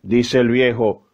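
ordinary talk, nothing marked

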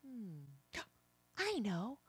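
ordinary talk

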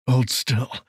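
plain reading voice

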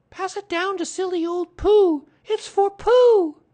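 put-on high, loud boyish call; excited shout. A narrator's reading. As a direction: call it joyfully down the table. Boy excitedly presents the gift.

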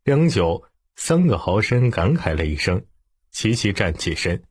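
plain reading voice

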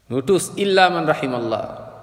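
normal talking voice

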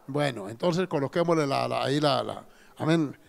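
ordinary talk

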